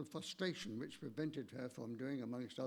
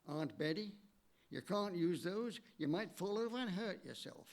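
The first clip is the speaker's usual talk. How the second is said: put-on nephew's warning in a Canadian accent; 5 semitones higher than usual. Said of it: "she put on a, a, a Canadian accent".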